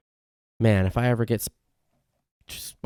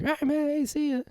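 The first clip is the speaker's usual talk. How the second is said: much higher, bouncy farewell; high cheery goodbye; 4 dB quieter than usual. Retold or imitated. Saying bye to the guys at the coffee shop, chirpy high voice. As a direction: wave them off in a chirpy high voice.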